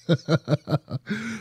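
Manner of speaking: soft staccato chuckle, rising at the end. Real, not acted. Host amused by his own bit about being sidelined, chuckling. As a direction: chuckle to yourself in quick, quiet pulses.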